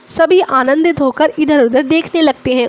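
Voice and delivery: ordinary delivery, nothing marked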